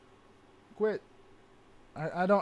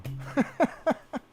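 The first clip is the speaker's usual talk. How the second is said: breathy laugh; 9 semitones higher than usual. Real, not acted. Laughing at his own misery.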